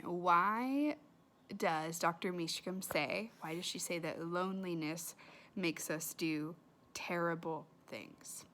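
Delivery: ordinary delivery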